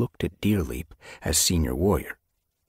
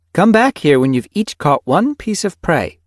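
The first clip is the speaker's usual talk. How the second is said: raised, louder mentor's instructions; giving directions; 12 semitones higher, 8 dB louder than usual. A narrator's reading. Mentor sends two apprentices off to hunt, directing. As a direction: direct the apprentices, higher and loud. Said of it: "she directed".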